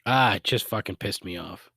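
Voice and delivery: ordinary talk, nothing marked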